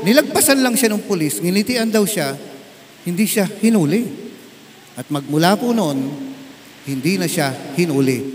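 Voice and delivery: usual speaking voice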